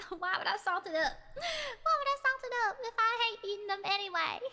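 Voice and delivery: laughing. Real, not acted. Laughing at own tomato idea.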